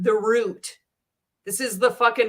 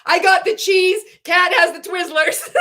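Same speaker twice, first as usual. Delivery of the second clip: high and loud, gleefully announcing the party; excited party invite; 10 semitones higher, 8 dB louder than usual. Retold or imitated. Quoting a viewer's party plans, excited and loud. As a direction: shout it like the best news ever. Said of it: "Dietitian Kat is like".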